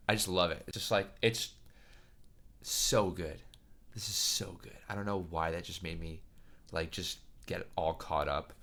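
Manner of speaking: holding back tears of joy, quietly; overwhelmed and softly tearful; teary. Real, not acted. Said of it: "that made me tear up".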